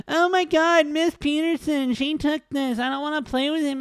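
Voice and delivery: high, whining schoolkid tattling; mock a complaining kid in a high-pitched whine; whiny fifth grader. Host mocking Rory as a kid telling on classmate, whiny. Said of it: "sounds like a fifth grader complaining to his teacher".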